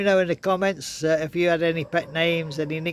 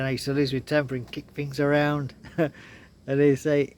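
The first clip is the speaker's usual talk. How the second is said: ordinary talk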